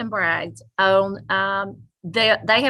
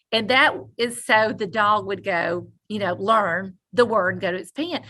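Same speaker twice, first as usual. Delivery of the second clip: ordinary talk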